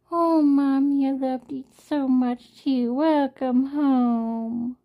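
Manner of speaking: high, loud cooing cat, slowing to a drawn-out welcome; cat voiced high and loud, welcoming mommy home; sweet kitty voice. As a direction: voice the adoring cat greeting mommy. Voicing a loving cat greeting her.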